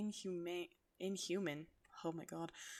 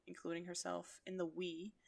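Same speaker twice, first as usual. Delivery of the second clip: usual speaking voice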